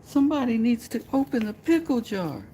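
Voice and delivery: very high, helpless falsetto damsel voice; helpless lady. Pretend. Helpless lady asking for someone strong, high falsetto.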